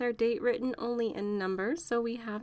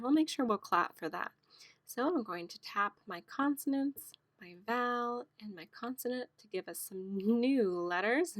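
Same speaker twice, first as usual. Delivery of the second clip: normal talking voice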